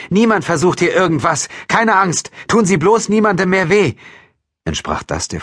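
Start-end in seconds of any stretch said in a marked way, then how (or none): 0.1-3.9 loud, high urgent appeal; raised voice, pleading firmly; calling out. A narrator's reading. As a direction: shout assurances through a door. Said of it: "rief Franziskowitsch".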